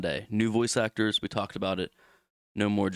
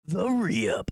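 announcer voice; 7 semitones higher, 4 dB louder than usual. Acted. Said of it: "I can't do it like you".

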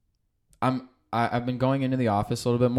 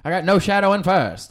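higher, louder race call; race announcer; 7 semitones higher, 7 dB louder than usual. Pretend. Naming racehorses, mock announcer calling the race. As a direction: start calling the horse race.

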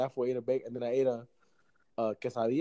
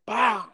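backfire noise; 7 semitones higher, 5 dB louder than usual. Retold or imitated. Imitating backfire again.

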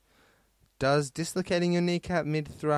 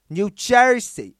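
high, loud, slow New Jersey accent; exaggerated New Jersey accent; 8 semitones higher, 10 dB louder, slower than usual. Shown as a demonstration.

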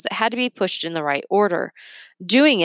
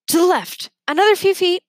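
calling out; 12 semitones higher, 5 dB louder than usual. Acted. Calling down from the ladder.

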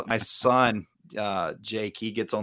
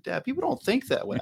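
higher-pitched light imitation of a son; son's voice. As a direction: lightly mimic your son correcting his dad. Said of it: "And he said".

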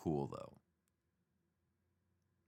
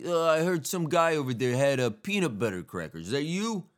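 higher, louder New York-ish squirrel; 4 semitones higher, 3 dB louder than usual. Pretend. Squirrel asks about peanut butter, mock New York accent. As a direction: play the squirrel asking with a tough accent. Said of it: "so they would be like".

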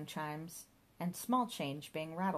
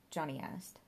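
ordinary delivery

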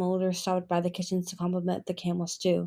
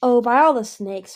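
louder, irritated snap; snapped; 3 semitones higher, 7 dB louder than usual. Acted. Sister snapping at Palm, irritated. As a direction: snap it out in exasperation. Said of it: "her sister snapped".

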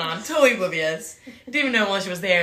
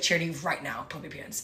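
low, hushed stern order; dead serious; 6 semitones lower, 8 dB quieter, faster than usual. Retold or imitated. Leader telling her to cover up, serious undertone. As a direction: drop the voice for a serious command. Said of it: "she literally gets so serious".

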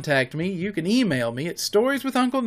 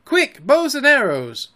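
high, loud command; raised, high-pitched urgency; urgent call; 9 semitones higher, 6 dB louder than usual. A narrator's reading. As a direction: call to arms in a hurry. Boys rushing to arm themselves.

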